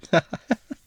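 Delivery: a short high laugh, amused; a high, bright burst of laughter. Acted. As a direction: laugh off the accusation. Amused at diamond accusation.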